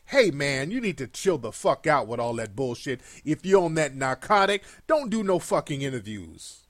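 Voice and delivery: gruff mock-friend warning; mock street advice. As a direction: talk down to him like an older homie. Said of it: "his coat and say".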